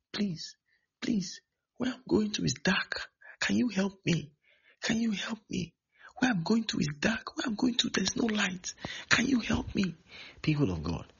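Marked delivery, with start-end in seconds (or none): 0.1-9.9 high-pitched, tearful begging; acted crying voice, thin and high; crying plea. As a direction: imitate someone weeping and begging for help. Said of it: "and is crying".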